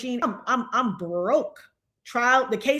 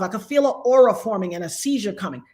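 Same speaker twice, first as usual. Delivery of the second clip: ordinary talk, nothing marked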